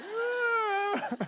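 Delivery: high, hammed-up little wail; mock cry. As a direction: imitate your own teary cry, playfully. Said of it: "It's like".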